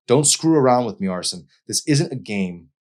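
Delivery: high and loud, furious at him; angry confrontation. A narrator's reading. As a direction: snap at him, she feels betrayed. Emery confronts Arson angrily.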